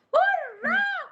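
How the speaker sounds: hurrah sung up high and bright; high cheer. Acted. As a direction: cheer it like a happy child. Cheer inside the song.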